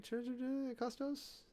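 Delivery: Ekastos stretched slowly with a high questioning rise; questioning Greek word. Shown as a demonstration.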